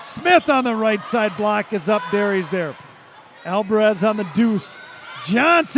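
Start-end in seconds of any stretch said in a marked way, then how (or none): 5.3-5.8 loud, high shout of the player's name; excited name call. Real, not acted. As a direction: cry out the scorer mid-play. Calls a kill during play.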